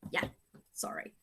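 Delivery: breathy whisper to the class; whispered sorry. Real, not acted. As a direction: whisper it as you hang up. Ending phone call mid-class.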